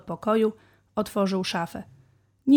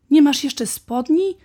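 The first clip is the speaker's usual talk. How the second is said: higher, louder and slow, in disbelief; dad can't believe it; surprised father; 7 semitones higher, 9 dB louder than usual. A narrator's reading. Father notices his son still has no trousers, astonished.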